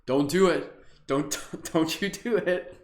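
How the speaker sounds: playful don't, breaking into a laugh; mock warning, laughing. Teacher teasing class not to yawn, laughing. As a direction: tease them not to yawn. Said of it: "Don't yawn".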